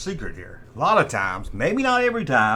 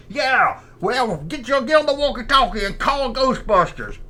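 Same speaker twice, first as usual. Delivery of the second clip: higher put-on ghost voice; goofy higher ghost calling over the radio; Slimer voice; 9 semitones higher than usual. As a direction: play Slimer calling his buddy. Slimer answering back.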